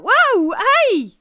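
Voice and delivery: high, loud cry of alarm; warning shout. Acted. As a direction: shout to halt an oncoming coach. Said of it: "Or shout".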